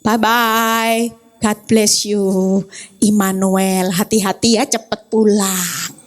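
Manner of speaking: wife blessing her husband as he leaves, tender and low; tender farewell. Retold or imitated. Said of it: "Saya bilang ini".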